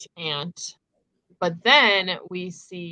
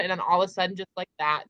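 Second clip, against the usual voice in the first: ordinary delivery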